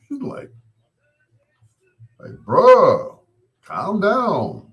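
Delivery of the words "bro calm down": loud, high, stretched-out plea; exasperated appeal. Retold or imitated. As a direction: call out to rein in a worked-up friend. Retold telling friend to calm down.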